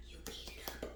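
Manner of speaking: breathy whisper of the punchline; mock-secret whisper; whispered aside. Shown as a demonstration. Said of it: "whispered repeat".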